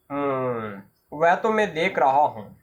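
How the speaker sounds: low, serious doctor's hum; grave doctor. Acted. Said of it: "अचानक वो गंभीर होकर". (गंभीर डॉक्टर की भारी, धीमी आवाज़)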